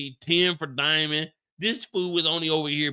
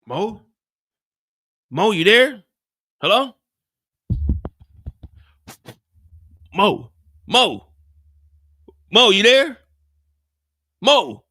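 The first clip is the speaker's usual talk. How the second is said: louder, raised calling into the line; 5 semitones higher, 9 dB louder, slower than usual. Retold or imitated. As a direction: call for him on the phone. Calling into dead phone.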